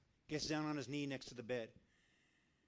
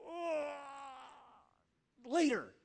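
whiny brush-off; 9 semitones higher, much slower than usual. Acted. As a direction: put God off with a hammed-up whine. Acted reluctant brush-off of God.

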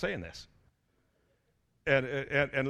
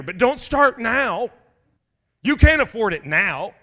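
slow, louder, drawn-out caution; slow mock warning; 5 semitones higher, 7 dB louder, slower than usual. Greed's voice cautioning the congregation, slow and louder. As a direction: stretch it out like a warning.

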